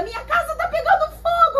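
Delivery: high, laughing imitation of a panic; a laughing retelling of her frantic shout; mock-panicked high cry. Woman shouting that her house burns.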